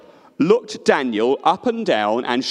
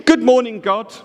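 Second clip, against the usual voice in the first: cheerful greeting; 5 semitones higher, 5 dB louder than usual. Acted. Daniel greets God cheerfully.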